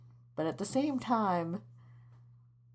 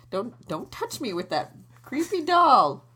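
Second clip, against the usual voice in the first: higher, louder squeamish recoil; mock-horrified, pitch jumping up; creeped-out protest; 6 semitones higher, 6 dB louder than usual. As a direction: fend it off, rising to a squeal. Recoiling from the doll.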